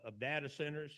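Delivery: ordinary talk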